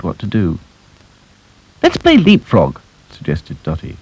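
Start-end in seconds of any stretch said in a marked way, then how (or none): 1.8-2.8 lightly higher eager voice; eager girl doll. Acted. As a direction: pitch it up like a keen little doll.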